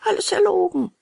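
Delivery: reply in a high-pitched puppet voice; high little puppet voice; puppet's retort. A narrator's reading. Puppet answers the old man.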